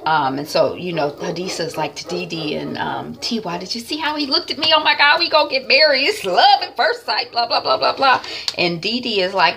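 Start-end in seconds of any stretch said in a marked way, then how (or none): 3.6-8.2 high, fast giddy chatter; giddy gushing. Retold or imitated. Vlogger acting Hadisa gushing about marrying the boss, high and fast. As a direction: rush it out, giddy with a crush.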